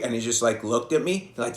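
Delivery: ordinary delivery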